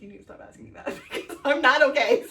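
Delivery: half plead, half laugh it out. Retold or imitated. Retold tearful plea to best man.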